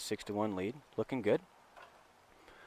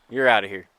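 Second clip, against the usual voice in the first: umpire's ejection call; 8 dB louder than usual. Retold or imitated.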